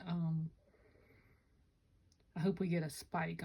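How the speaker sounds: ordinary delivery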